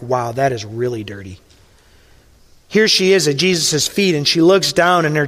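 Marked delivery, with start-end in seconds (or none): 0.0-1.4 mock dismayed aside. Mock reaction to dirt.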